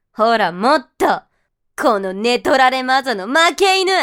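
contemptuous berating. Acted. Dominant partner berates masochist.

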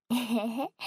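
loud little giggle; playful heh-heh; teasing giggle. Acted. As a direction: giggle at his embarrassment.

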